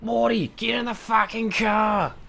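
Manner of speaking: bad Australian Rick impression. Streamer doing a bad Australian accent for Rick. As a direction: yell it like a bad Aussie Rick cartoon. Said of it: "I butcher your beautiful, beautiful accent".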